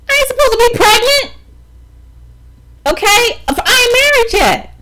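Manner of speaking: high, loud, crying-out indignation; high and loud, near tears; outraged church voice. Retold or imitated. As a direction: shriek it like a scandalized church lady. Church rules on being pregnant unmarried.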